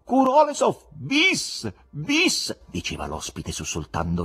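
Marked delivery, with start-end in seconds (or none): 0.1-2.6 much higher, louder mock cries; a hammed-up shouted bis bis; retold shouting. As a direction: imitate a madman bellowing encores. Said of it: "urla di continuo".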